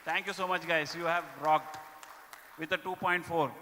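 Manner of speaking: loud, upbeat awards announcer; award announcement. Acted. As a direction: announce the winners to the room. Announcing top states and branches.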